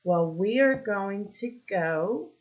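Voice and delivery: ordinary talk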